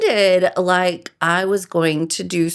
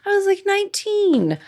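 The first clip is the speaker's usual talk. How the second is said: much higher, airy dismissive excuse; breezy excuse. Retold or imitated. Excusing her scary teenage behavior, high and breezy. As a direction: brush it off in a high sing-song voice. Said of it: "And I'm like".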